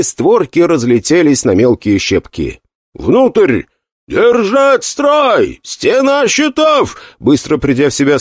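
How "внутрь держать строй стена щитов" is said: high, very slow battle orders; barked commands. Acted. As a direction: command the troops, drawn out. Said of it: "скомандовал Гуан Юй". (командный крик)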